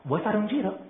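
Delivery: lightly high, casual invitation on "giro"; sudden offer. A narrator's reading. She suddenly invites the young chameleon, breezy and casual. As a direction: toss off the invite. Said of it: "mi disse improvvisamente".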